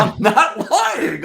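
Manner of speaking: shouted high and slow in disbelief; high protesting shout. Retold or imitated. To the suspicious prospect, a high slow outraged shout. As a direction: yelp it in outraged innocence.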